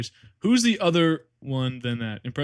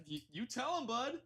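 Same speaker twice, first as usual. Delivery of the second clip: soft high pet voice; 10 semitones higher, 16 dB quieter than usual. Pretend. Voicing a pet/companion.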